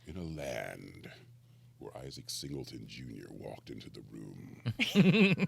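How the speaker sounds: low, slow epic announcer; movie trailer voice. Retold or imitated. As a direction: build an epic trailer intro. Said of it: "He always set it up where he's like".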